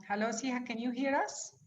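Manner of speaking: ordinary delivery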